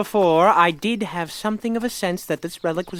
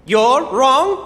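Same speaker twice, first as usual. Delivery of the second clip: shocked disbelief; 7 semitones higher, 9 dB louder than usual. Acted. Stunned disbelief at revelation.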